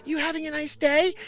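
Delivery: high-pitched put-on small talk; mock scammer woman. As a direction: imitate her chatting in a high voice. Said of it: "She goes".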